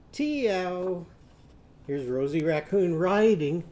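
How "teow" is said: drawn-out "Teow" like a meow; cat's meow with T. Shown as a demonstration. Teacher answers as Timmy Tiger's cat, meowed "Teow". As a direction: mew it with a T at the front. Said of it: "Timmy Tiger's cat going to be?".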